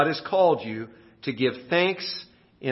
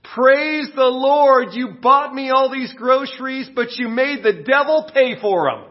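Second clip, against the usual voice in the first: much higher, louder joyful shout; triumphant praise; 10 semitones higher, 6 dB louder than usual. Acted. Widow answering the atheist, triumphant shout. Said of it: "lifts her arms again and says".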